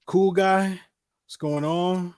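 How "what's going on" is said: ordinary talk, nothing marked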